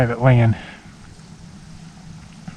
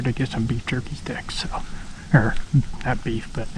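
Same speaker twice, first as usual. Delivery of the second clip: whispering; 8 dB quieter than usual. Real, not acted. Dropping to a whisper.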